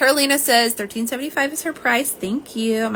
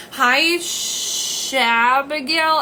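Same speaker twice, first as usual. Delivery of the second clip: drawn-out happy greeting; 4 semitones higher, 6 dB louder, much slower than usual. Real, not acted. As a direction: stretch the greeting out, slow and louder, sing-song. Greeting a viewer on live stream.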